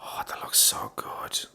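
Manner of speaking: breathless whisper of awe. Real, not acted.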